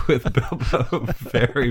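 laughing. Real, not acted. Laughs mid-sentence.

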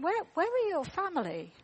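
lightly voiced caring grown-up; gentle adult to child. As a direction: ask a lost child kindly. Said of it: "and we're going".

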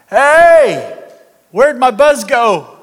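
high, loud, drawn-out holler; indignant mock shout. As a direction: holler it like a drunk robbed of his high. Drunk man suddenly sober, shouting.

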